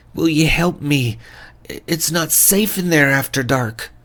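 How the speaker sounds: desperate Scots-flavoured fisherman begging for help; distraught fisherman accent. Acted. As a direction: play the panicked father in a Scots-tinged accent. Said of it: "I didn't do the best accent there".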